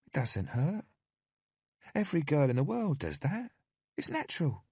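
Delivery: high, sugary caretaker voice; falsely sweet and cajoling; sweet coaxing woman. A narrator's reading. Narrator voices Candy.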